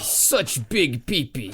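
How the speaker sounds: slow, drawn-out put-on voice; goofy creature voice. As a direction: savour it like a dumb monster. Silly pee-pee bit.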